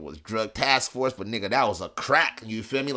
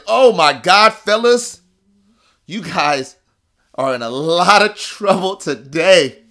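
booming, high-pitched exclamation; cop's loud astonishment; 7 semitones higher, 12 dB louder, slower than usual. Retold or imitated. Cop to the two on finding weed, loud astonishment. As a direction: crow it out, stretching the bad news.

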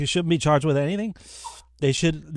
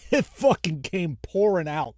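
laughing slowly; 5 semitones higher, slower than usual. Real, not acted. Hot sauce spilling, laughing.

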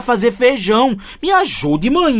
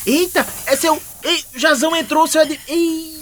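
calling from far away; 8 semitones higher than usual. Acted. Son alarmed as dog runs in.

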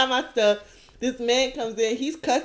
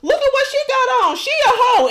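mocking angry girl; 12 semitones higher, 4 dB louder, faster than usual.

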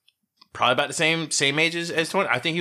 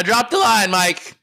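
louder, higher insistence; raised-voice protest; 6 semitones higher, 6 dB louder, slower than usual. Real, not acted. Co-host admitting the slip to Mike, raised-voice protest. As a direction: protest loudly, pitched up.

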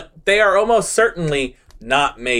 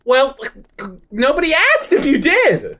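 laughing retort; 11 semitones higher than usual. Pretend.

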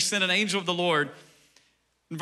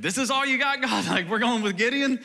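higher, incredulous, half-laughing; can't believe the choice, lightly mocked; mock disbelief; 5 semitones higher than usual. Onlooker scoffing at God's pick, mock disbelief.

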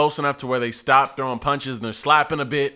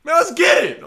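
high, raised hype shout; yelling along; 14 semitones higher, 5 dB louder than usual. Retold or imitated. Bystander joins in on the fight, yelling. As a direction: reenact joining the crowd's yelling. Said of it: "I'm yelling too now".

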